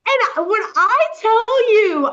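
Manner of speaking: high, loud burst of disbelief; worked-up exclamation. Real, not acted. Reader telling friend about a shocking scene, worked-up exclamation. Excited reaction to the book scene.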